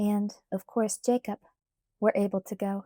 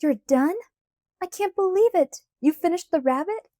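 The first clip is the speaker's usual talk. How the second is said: excited; 8 semitones higher, 5 dB louder than usual. A narrator's reading. Thrilled at finished car.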